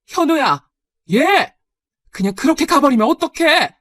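loud, high exasperated shout; calling after him. Acted. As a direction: yell after someone walking out on you. Mother calls after departing son. (나가는 아이를 다급하게 소리쳐 부르는 말투)

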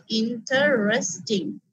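"Interesting" broken slowly into in-ter-res-ting; four syllables. Shown as a demonstration. Teacher counts sounds for learners, "interesting" syllable by syllable. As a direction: give each of four sounds in "interesting" its beat. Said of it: "কতগুলো sound হচ্ছে".

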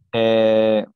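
usual speaking voice

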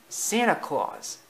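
high, drawn-out character voice giving the answer; explaining. A narrator's reading. Thumper answers Bambi's question, explaining. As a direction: state it slowly and high, as the answer. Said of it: "explained Thumper".

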